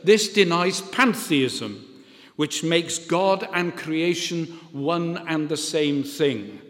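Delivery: ordinary talk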